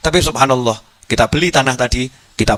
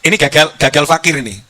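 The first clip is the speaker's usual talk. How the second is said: ordinary talk, nothing marked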